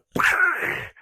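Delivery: high, rattling shotgun noise; imitated gunfire. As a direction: mimic shotguns going off. Imitates shotguns fired in the air.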